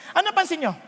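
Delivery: ordinary talk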